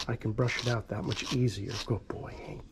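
softly whispered, no voice; whispered praise. Real, not acted. Groomer whispering praise to the dog while brushing, hushed. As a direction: whisper it to keep the dog calm.

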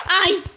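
high, loud ouch; sharp pained yelp. Real, not acted. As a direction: yelp when hit. Hit by mob. (grito de dor agudo)

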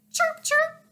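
squeaky-high bird chirps; chirping. A narrator's reading. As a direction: pipe up like a little bird. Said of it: "perched on Rosie's shoulder and chirped".

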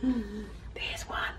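hushed gasp then whisper; excited whisper. Acted. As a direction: breathe it out in wonder. Spotting cicada at night.

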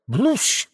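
high comic blast noise; explosion noise. Acted. Sound effect of the battle, comic blast. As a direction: splat it out like a cartoon explosion.

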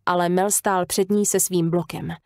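plain reading voice

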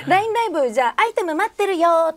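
high, upbeat cute announcement; cute host call. Shown as a demonstration. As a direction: coax fans cheerfully. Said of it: "とか来ませんか？".

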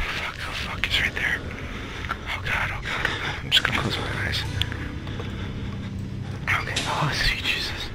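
breathless frightened whisper under the breath; terrified whisper. Real, not acted. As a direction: whisper it so the monster won't hear. Hiding, monster right there.

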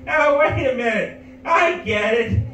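put-on high, loud cackling old lady; old woman in the back heckling the comic, shrill mock shout; old woman heckler. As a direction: shout the insult like a gleeful granny.

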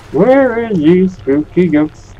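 high, slow and loud calling out; sing-song call. Real, not acted.

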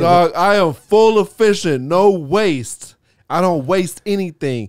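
loud, high, emphatic mock boast; hammed-up bragging. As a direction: proclaim it like an absurd point of pride. Joins the bit, boasting about efficiency.

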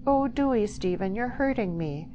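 high, distressed entreaty; pleading. A narrator's reading. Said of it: "she pleaded".

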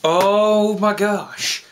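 slow, drawn-out, loud amazement; excited fan awe; thrilled gasping reaction. Real, not acted. Thrilled reaction after trailer.

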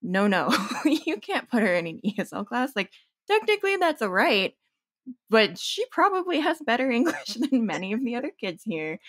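a parent insisting, half laughing; parents protesting. Retold or imitated. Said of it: "they were like".

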